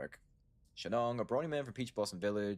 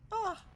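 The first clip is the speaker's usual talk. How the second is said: sudden shrill cry, much higher and louder; high scream; 4 dB louder than usual. Acted. Shun Shun attacked mid-sentence, sudden high scream. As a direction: scream as if grabbed.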